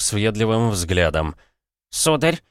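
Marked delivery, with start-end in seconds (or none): 1.9-2.4 put-on high, prim old butler voice; polite butler. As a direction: greet him like a fussy old butler. Butler greets the guests.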